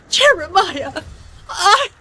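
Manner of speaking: crying. Acted. Mother crying to her son about being kicked out, weeping. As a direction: sob it out, wounded mother.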